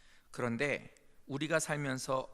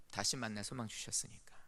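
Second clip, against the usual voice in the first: whisper; 6 semitones lower, 6 dB quieter than usual. Real, not acted. Hope of reunion after death.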